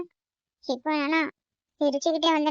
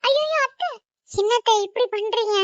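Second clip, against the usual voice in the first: sudden high alarmed cry, then a little less high; shocked protest; 6 semitones higher than usual. Acted. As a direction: stop her in alarm as she moves to fall at your feet. Aunt offers to fall at feet. (அதிர்ச்சியுடன் உயர்ந்த குரலில் தடுத்தல்)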